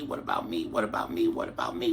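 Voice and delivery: low, soft, half-whispered pleading; tiny voices asking quietly. Acted. Small birds begging quietly.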